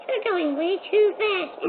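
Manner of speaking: very high, slow squeaky mock voice; weird high-pitched tone. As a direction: squeak it out slowly like a silly cartoon. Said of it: "this weird high-pitched tone".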